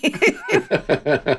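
giggling as you repeat it; laughing name callout. Real, not acted. Laughing, echoing the friends' running joke.

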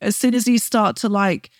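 ordinary talk, nothing marked

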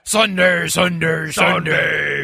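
shouted monster truck rally promo; monster truck announcer. Pretend. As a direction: bellow it like an arena ad. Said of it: "the monster truck voice".

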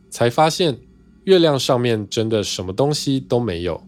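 usual speaking voice

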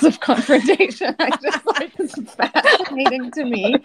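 a high, laughing marvel; laughing in disbelief. Real, not acted. To her co-hosts, laughing in amazed disbelief. Amazed at friend's confrontation levels.

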